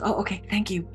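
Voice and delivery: small, soft meek reply; shy. Retold or imitated. Shy woman thanking a stranger in line, meekly. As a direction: imitate a timid woman backing off quietly. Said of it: "she seems very kinda shy".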